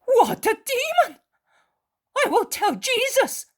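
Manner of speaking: very high, loud outraged cry; indignant exclamation. Acted. Mary of Alphaeus exclaiming about Judas. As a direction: burst out in indignation. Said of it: "exclaims Mary of Alphaeus".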